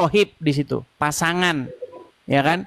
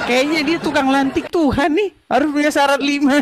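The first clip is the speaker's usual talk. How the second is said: high, laughing ridicule; laughing mockery; 8 semitones higher than usual. Real, not acted. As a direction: laugh at him while talking. Mocking laugh at opponent. (mengejek sambil tertawa)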